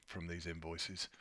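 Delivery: normal talking voice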